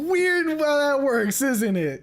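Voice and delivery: high, slow teasing voice; drawn-out high mocking tone; sing-song gotcha tease. Teasing after being put on the spot.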